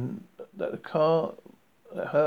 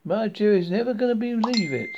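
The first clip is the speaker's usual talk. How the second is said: high, delighted Southern woman; lightly voiced Southern gal; friendly Southern accent; 6 semitones higher, 4 dB louder than usual. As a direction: marvel out loud in a Southern lilt. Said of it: "speaking in a friendly southern accent".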